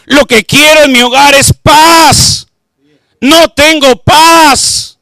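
loud, fervent sermon delivery; preaching at full force. Acted. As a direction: preach it out to the congregation.

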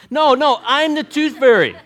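high, loud proud tooth fairy announcement; mock declaration. Preacher jokingly claims to be the tooth fairy, loud and high. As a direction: proclaim it like a big reveal.

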